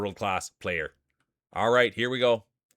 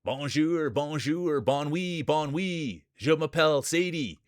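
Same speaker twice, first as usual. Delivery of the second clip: hammed-up French accent, higher; mock French; 5 semitones higher, slower than usual. Greets the Quebec racer in mock French accent. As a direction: put on a playful fake French for Sadie. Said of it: "Get a French speech".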